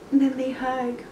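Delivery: plain reading voice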